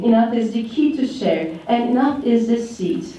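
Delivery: usual speaking voice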